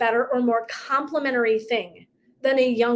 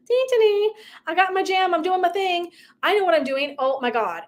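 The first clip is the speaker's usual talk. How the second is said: high, sing-song oblivious voice; mock carefree self; 7 semitones higher than usual. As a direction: play your clueless past self. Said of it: "and I was like".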